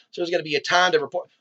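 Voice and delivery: ordinary talk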